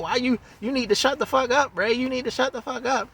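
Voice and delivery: hammed-up sister snapping at her brother; mock scolding. Kim telling Ray to shut up, mock angry and high.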